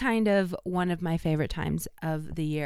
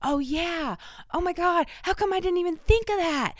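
building excitement; 11 semitones higher than usual. Pretend. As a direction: climb into a thrilled, squealing realization. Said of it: "makes you like".

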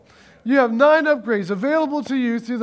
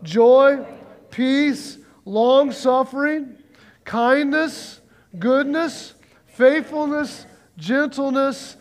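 higher, one word at a time with pauses; leading group recitation; 3 semitones higher, much slower than usual. Real, not acted. Preacher leading congregation to say it together, higher word-by-word chant. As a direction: call out each fruit for "Joy, peace, long-suffering" to be echoed. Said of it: "say this with me".

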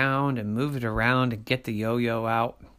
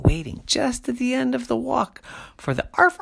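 ordinary delivery, nothing marked

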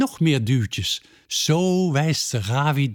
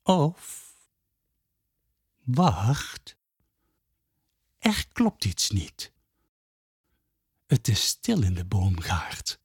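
suspenseful hush; 4 semitones lower, 4 dB quieter than usual. A narrator's reading. Suspense turn in narration.

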